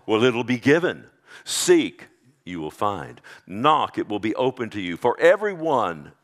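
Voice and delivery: loud, ringing pulpit delivery; full-force preaching. Acted. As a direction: preach it out to the congregation.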